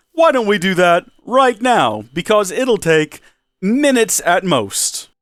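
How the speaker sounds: ordinary delivery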